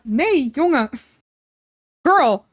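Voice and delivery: sharp, high, loud exclamation; exasperated scolding. Real, not acted. To the pet on the desk, exasperated.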